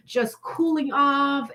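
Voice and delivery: normal talking voice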